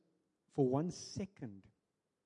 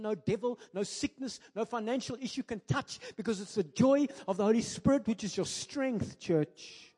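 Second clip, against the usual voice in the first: louder, higher preaching voice; full-force preaching; 7 semitones higher, 6 dB louder than usual. Acted. Preacher lifting the church about joy of the Holy Spirit, fervently. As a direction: preach it out, rising to a climax.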